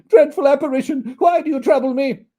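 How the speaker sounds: put-on high, loud frightened old man; terrified plea. Scrooge pleading with the ghost, high and loud. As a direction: beg the ghost to leave.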